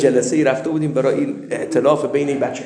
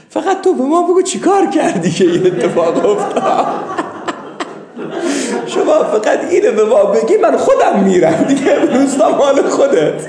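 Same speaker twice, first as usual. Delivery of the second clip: high, loud words bubbling with real laughter; can barely get the headman's plea out for laughing; laughing while telling; 9 semitones higher, 7 dB louder than usual. Real, not acted. Teller to listeners, voicing the begging headman, laughing hard.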